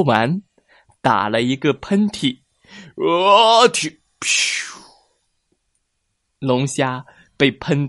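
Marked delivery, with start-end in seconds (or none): 3.0-4.8 sneeze. Acted. Whale sneezes.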